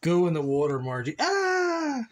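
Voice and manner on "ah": sudden high-pitched cry; long, high wail of "ah"; high drawn-out "ah". Real, not acted. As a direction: let out a high, sustained yelp. Sudden cry, no real word.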